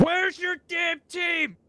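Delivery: slow, high angry yell; frustrated shout. Real, not acted. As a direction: yell it out, exasperated. Shouting at an enemy.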